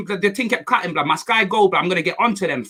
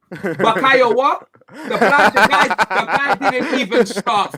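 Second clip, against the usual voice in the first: laughing mockery; 5 semitones higher, slower than usual. Real, not acted. Mocking rival player while laughing.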